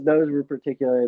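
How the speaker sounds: normal talking voice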